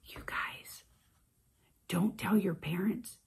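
secret whisper; hushed conspiratorial whisper. Acted.

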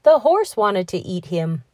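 ordinary delivery

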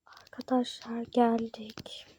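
soft whisper to the viewers; whispered arrival. Pretend. Vlogger telling friends they have arrived, hushed whisper. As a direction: whisper it softly, like a secret update.

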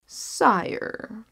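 lightly voiced scheming vizier. Vizier addresses the king.

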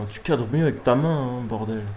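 usual speaking voice

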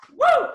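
a loud, high whoop of excitement; excited whoop. Real, not acted. Hyped up while pitching the business, a loud high whoop. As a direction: let out a big woo.

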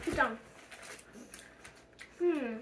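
tasting candy while speaking; chewing while talking. Real, not acted. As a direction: mouth partly full. Seen 0: Pajama child ate candy at -5, jaw working, tongue out after.

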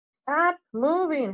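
bossy order, drawn out. A narrator's reading. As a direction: tell them firmly to freeze. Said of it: "Hermione ordered them".

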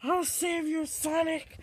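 high-pitched heroic call; play rescuer. Pretend. Rescuer to Sonic under attack, high-pitched call. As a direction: call out high to a friend in danger.

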